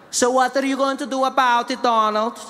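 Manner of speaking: a hint of Melania's Slovenian accent; Melania impression. Melania challenging Donald, light Slovenian accent.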